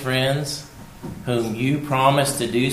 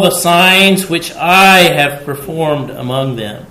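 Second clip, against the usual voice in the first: slow, loud, raised voice of God's complaint; booming scripture reading; 5 semitones higher, 9 dB louder, slower than usual. Acted. Preacher voicing God's complaint, slow and booming. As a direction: read "How long will these people reject me" like God grieved and angry.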